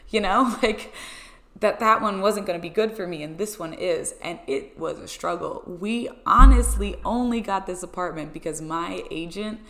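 usual speaking voice

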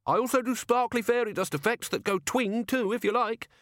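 very high, pleading gnome voice; desperate gnome. A narrator's reading. Gnome offers fairy effects to Ridcully, high and desperate. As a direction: pitch it right up, desperate to be allowed to stay. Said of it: "said the gnome desperately".

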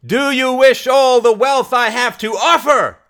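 high, loud rallying question; shouted challenge. Acted. Mary offering wealth to the crowd, high loud shout. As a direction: project the offer over a mob.

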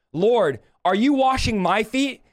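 ordinary talk, nothing marked

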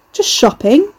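high, loud and cheeky; loud reply. Acted. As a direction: call it out for everyone to hear. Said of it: "he replied loudly over his shoulder".

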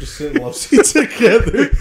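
high, loud, laughing through it; laughing mock repeat. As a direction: giggle while echoing. Mocking repeat of partner's suggestion.

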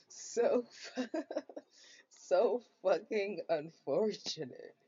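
loud laughing, words stretched out slowly. Real, not acted. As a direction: laugh through it at how bad it was. Laughing at her bad birthdays.

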